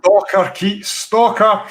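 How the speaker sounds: loud joking tease at a friend; teasing call-out. Real, not acted. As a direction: rib him with a mock accusation. Teasing a viewer who lives nearby.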